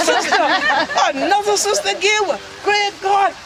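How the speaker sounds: high-pitched outburst of dismay; dismayed son. Acted. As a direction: exclaim it high, aghast at the news. Son hears she is another sister.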